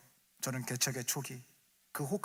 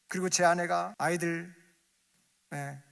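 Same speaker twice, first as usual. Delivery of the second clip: ordinary talk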